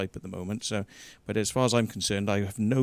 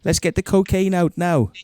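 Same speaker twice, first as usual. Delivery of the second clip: mock caller voice; 6 semitones higher, 5 dB louder than usual. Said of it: "he's like".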